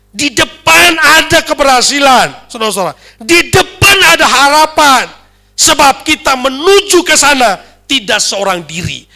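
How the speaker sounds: high, loud rallying proclamation; full-force preaching. Real, not acted. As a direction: drive each phrase home to the congregation. (khotbah berapi-api, lantang)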